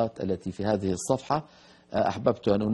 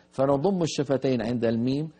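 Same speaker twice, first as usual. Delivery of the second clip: usual speaking voice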